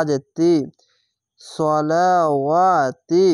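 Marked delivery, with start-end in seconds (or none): 1.4-3.2 সলা ওয়া drawn out very slowly; stretched recitation. Shown as a demonstration. Teacher drawing out the alif for students. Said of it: "খারজা বারে এক আলিফ টেনে পড়বেন".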